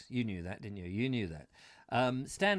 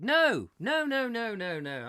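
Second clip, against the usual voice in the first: high, rapid-fire string of noes falling away; emphatic refusal; 7 semitones higher, 5 dB louder, slower than usual. Real, not acted. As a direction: refuse outright, starting high and loud. Refusing the dare of lie-in.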